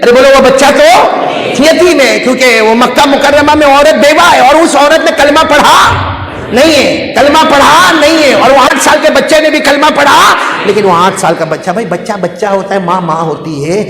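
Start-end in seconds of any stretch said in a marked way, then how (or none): 0.0-10.3 high, loud sermon climax; preaching at full force. Acted. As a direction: preach it out to the crowd. (زور دار وعظ کا انداز)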